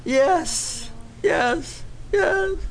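rising, crying yes; tearful yes. Retold or imitated. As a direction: let the yes crack with tears. Father accepts, crying.

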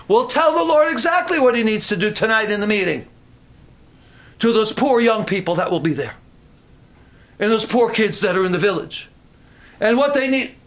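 high, bossy mock prayer; impassioned preaching. Preacher mimicking people instructing the Lord, impassioned.

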